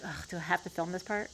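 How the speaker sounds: mock end-of-project reluctance; reluctant groan. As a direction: grumble it under your breath, dreading the chore. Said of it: "towards the end of the project, it's like".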